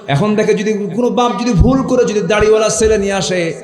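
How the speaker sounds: high, loud sermon chant; full-force preaching. Acted. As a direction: preach it out high and ringing to a crowd.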